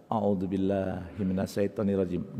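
slow, soft, low prayer; unhurried, hushed invocation; mindful recitation. Shown as a demonstration. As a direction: recite it quietly with full awareness. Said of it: "Dengan penuh kesadaran ya".

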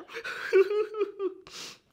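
high, playful giggle. Acted. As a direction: laugh brightly at the word. Laughing at upset.

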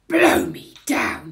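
big drawn-out exclamation; flabbergasted burglar character; astonished burglar. A narrator's reading. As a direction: blurt it out slowly, very loud. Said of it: "he says".

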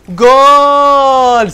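stretched triumphant goal scream; real goal shout. Real, not acted. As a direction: hold the goal cry like a commentator. Scores a goal live.

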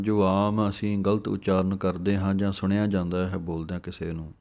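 ordinary delivery

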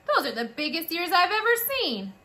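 amazed sister. A narrator's reading. Older sister mouse staring at the new baby's ears, amazed. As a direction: gawk at the huge ears.

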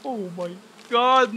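drawn out in rising fear; frightened cry. Real, not acted. Jump scare in horror game.

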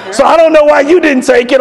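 high, pushy student complaint; accusing. Retold or imitated. Student challenging a teacher over late work, accusing.

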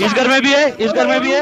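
high, loud enemy callout; urgent callout. Real, not acted. Player warning squad of enemies in this house, urgent callout. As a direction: call it out urgently, pitch jumping up.

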